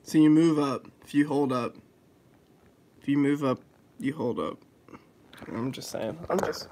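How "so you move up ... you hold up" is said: forceful, loud insistence; nearly shouting the point. Real, not acted. Arguing about game controls.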